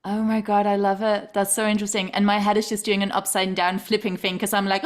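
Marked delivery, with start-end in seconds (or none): none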